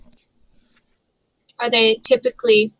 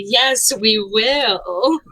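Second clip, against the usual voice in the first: very slow, stretched words; drawn-out insistence; much slower than usual. Acted. Player volunteering friends with a look, drawn out. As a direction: draw each word out meaningfully.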